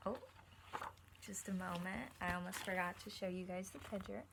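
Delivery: ordinary delivery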